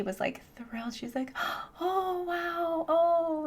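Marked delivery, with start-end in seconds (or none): 1.6-3.5 put-on high, gasping child's wonder; delighted gasp. Imitating the girl seeing the dog, gasping with delight. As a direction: imitate a thrilled kid.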